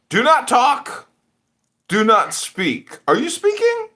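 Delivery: loud, high-pitched scolding; mock judge's command. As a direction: silence them like a hammed-up judge. Mimics a judge silencing people.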